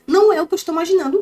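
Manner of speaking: mocking repeat. Daughter sarcastically echoing her father's cliché. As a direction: throw his line back at him.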